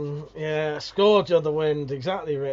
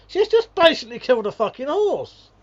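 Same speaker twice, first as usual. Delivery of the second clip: outraged rant; 9 semitones higher, 8 dB louder than usual. Real, not acted. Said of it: "I got a rant about something".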